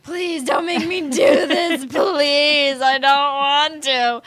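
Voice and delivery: whiny pleading with a laugh, slow and loud; begging. Retold or imitated. Kid begging mom not to make her go, whiny. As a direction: beg mom like a kid near tears. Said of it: "I just remember begging you".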